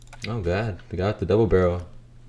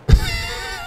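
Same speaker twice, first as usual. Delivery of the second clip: squeaky laugh; 3 dB quieter than usual. Real, not acted.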